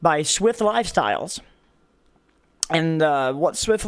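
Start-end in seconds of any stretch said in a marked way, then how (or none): 0.0-1.4 ad read. Acted. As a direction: announce the sponsor grandly. Starting the sponsor read.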